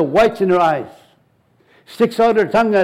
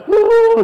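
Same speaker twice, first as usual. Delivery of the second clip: mock ooh; 13 semitones higher, 4 dB louder than usual. Mocking people falling under the power.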